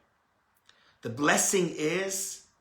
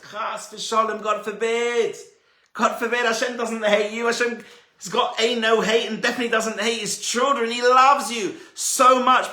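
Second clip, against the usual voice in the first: higher and louder, pleading that she is loved; passionate reassurance; 7 semitones higher, 7 dB louder than usual. Retold or imitated. Rabbi retelling his fervent answer to a sad student. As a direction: insist fervently, God forbid, he loves you. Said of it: "And I said".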